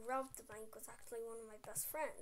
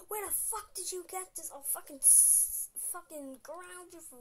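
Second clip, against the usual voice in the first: angry mum; 7 semitones higher than usual. Retold or imitated. Angry mum voiced in story.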